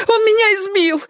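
high, gasping female appeal; breathless plea. A narrator's reading. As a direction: appeal to Robin for pity. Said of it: "задыхаясь, воззвала она".

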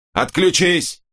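high, sharp snap; barked order. A narrator's reading. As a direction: bark him off the line. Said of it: "рявкнул Корво". (резко рявкнуть)

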